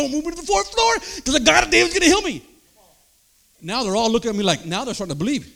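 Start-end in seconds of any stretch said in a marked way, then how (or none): 0.0-2.4 loud, high, declaring it; insistent proclamation. Retold or imitated. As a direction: insist on healing, full voice. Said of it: "I told them".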